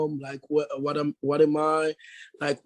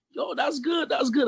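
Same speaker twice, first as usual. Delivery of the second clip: quoting friends; 11 semitones higher than usual. Retold or imitated. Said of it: "They was like".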